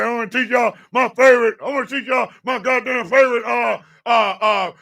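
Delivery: high-pitched one-sided-mouth drill sergeant. Retold or imitated. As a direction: bark instructions out of one side of the mouth. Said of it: "That's exactly how he talks".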